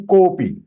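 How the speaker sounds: kopi with a slightly stretched "ko"; one-beat extension. Shown as a demonstration. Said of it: "When it is extended one time".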